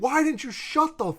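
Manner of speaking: high-pitched exasperated yell, held back in volume; mock screaming. Podcaster acting out the man berating the trap victim, mock screaming. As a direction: imitate a frustrated outburst, pitch way up then dropping off. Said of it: "starts screaming".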